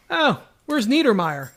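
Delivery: ordinary delivery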